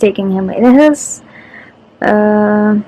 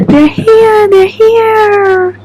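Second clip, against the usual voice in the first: much higher and louder, thrilled; excited; 11 semitones higher, 5 dB louder, much slower than usual. Real, not acted. Spotting the car bringing the new puppy, a high excited squeal.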